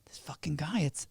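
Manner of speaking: hushed, half-whispered acted thinking; muttered inner confusion. Title clerk doubting her suspicion to herself, hushed whispery muttering. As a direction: puzzle it out slowly under your breath.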